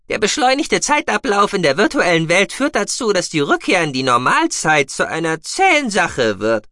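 high, loud piping creature voice; talkative mousebeaver. A narrator's reading. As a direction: explain it in a high, forceful little voice. Guki figure in audiobook. (hohe, laute Mausbiberstimme, erklärend)

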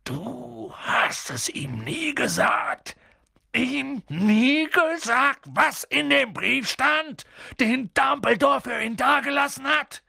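giant's indignant, swelling shout; trembling with indignation. A narrator's reading. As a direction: draw out every word in outrage. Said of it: "zitterte jede Silbe vor Entrüstung".

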